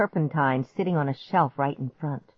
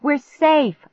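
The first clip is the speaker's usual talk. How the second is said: high, loud triumphant cheer; jubilant, raised voice; 8 semitones higher, 5 dB louder, slower than usual. A narrator's reading. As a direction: cry out in relieved victory. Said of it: "I cheered".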